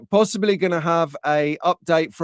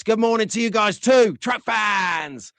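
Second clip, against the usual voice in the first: shouted greeting; 5 semitones higher than usual. Real, not acted. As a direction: hail the fans heartily.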